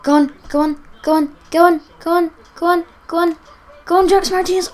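will the striker toward goal; urging on. Real, not acted. Gamer urging his striker on as he runs at goal.

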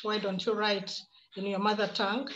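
ordinary talk

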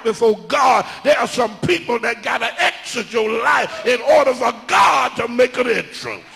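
loud, high-pitched sermon climax; full-force preaching. Acted. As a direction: drive it home like a fired-up preacher, building to a shout.

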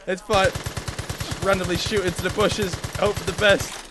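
ordinary delivery

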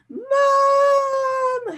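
put-on a panicked boy howling for his mother. A narrator's reading. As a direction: wail for Mom at full volume. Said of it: "howled Dudley".

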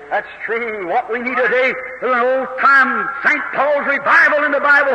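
usual speaking voice

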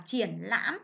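triển lãm said slowly, with a dipping hỏi tone on triển and a broken ngã tone on lãm; triển lãm, slow and precise for pupils; careful pronunciation. Shown as a demonstration. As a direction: give triển lãm both tones fully and slowly. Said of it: "chú ý cho cô vần yên, dấu hỏi và vần am, dấu ngã".

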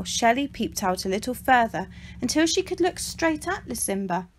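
usual speaking voice

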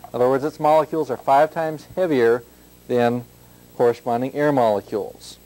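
normal talking voice

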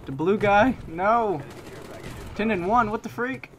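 high, loud alarm; panicked yelling. Real, not acted. Under chopper fire in the match, high panicked yells. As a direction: shout as the chopper hits you.

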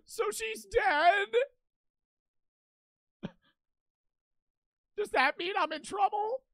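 slow, high, sobbing mock despair; fake crying. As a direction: wail it like fake tears. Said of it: "let me fake cry".

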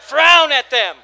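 high, loud playful order; hammed-up loud frown command. Shown as a demonstration. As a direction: bark it with a frown. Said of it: "use the hundred and two muscles in your face".

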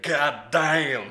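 exaggerated Texan twang; imitating angry Texan. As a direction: mimic the Texan's drawled curse. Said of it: "the more he said".

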